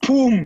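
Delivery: loud high mock bang; sudden shouted pum; imitated gunshot bang. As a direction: pop it out like a shot. Retelling a gunshot at party.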